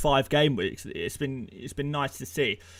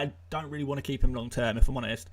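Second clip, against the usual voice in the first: ordinary talk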